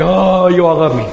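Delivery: lightly voiced self-satisfied dog; a hint of a pampered dog basking; smug corgi voice. Corgi enjoying the crowd's attention, lightly voiced smug. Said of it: "my corgi ... going".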